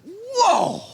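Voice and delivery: high, sudden exclaim of shock; stunned whoa. Retold or imitated. Pundit reliving a shock knockout, high stunned whoa. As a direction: gasp it out like you just saw the knockout.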